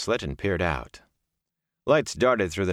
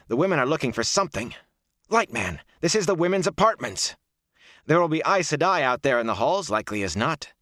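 high-pitched, exasperated reprimand; urgent scolding; 8 semitones higher, 3 dB louder than usual. A narrator's reading. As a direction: scold him urgently to hold him back. Scolds him while holding him back.

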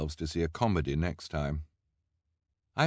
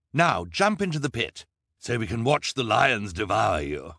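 loud, raised villainous order; commanding villain; 3 semitones higher, 5 dB louder than usual. A narrator's reading. Count Olaf ordering the freaks into the pit, loud commanding villain. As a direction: order them loudly to their doom.

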